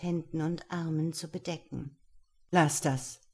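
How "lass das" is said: firm, commanding order; stern command. A narrator's reading. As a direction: order her, strictly. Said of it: "befahl ihr der Geheimnisvolle streng". (strenger Befehl)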